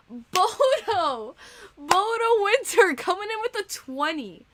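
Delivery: high, loud and drawn out, hyping the donor; excited donation shoutout. Real, not acted. Streamer hyping up a donor's twenty to chat, excited and loud. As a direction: call the name out like an announcer.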